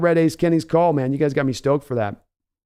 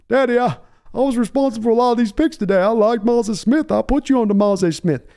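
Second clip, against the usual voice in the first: higher, meek Texan son; Stephen Jones whining; 7 semitones higher than usual. Pretend. Son claiming credit for picks to father, meek Hank Hill voice. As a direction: proudly defend your picks. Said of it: "mealy mouth".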